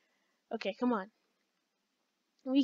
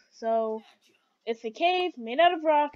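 usual speaking voice

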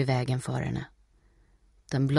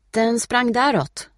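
high, loud, slow answer; small boy; 8 semitones higher, 7 dB louder, slower than usual. A narrator's reading. Small boy directing Steffi, high childlike voice. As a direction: point the way like a young child.

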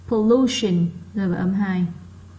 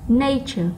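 NA in "nature" pushed higher; stressed first syllable; 4 semitones higher than usual. Shown as a demonstration. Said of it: "nó to hơn những âm còn lại". (nhấn mạnh âm một của "nature")

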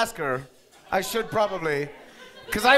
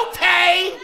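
mock okay; 8 semitones higher, 7 dB louder than usual.

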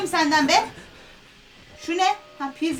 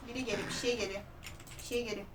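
much softer hushed warning; frightened whisper-quiet; 5 semitones lower, 14 dB quieter than usual. Real, not acted. Gamer warns herself as something approaches, hushed and scared. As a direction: keep your voice down, something comes.

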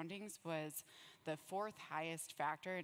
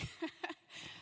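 short laugh; 12 semitones higher than usual. Real, not acted. Laughs about the trash puppets.

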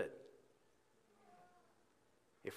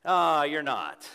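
dismissive God voice; 3 semitones higher, 10 dB louder, much slower than usual. Retold or imitated. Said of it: "And he might be saying".

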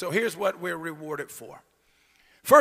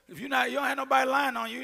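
normal talking voice

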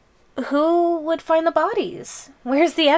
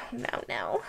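whisper; 2 semitones lower, 13 dB quieter than usual. Acted. Hushed denial.

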